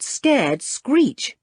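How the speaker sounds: usual speaking voice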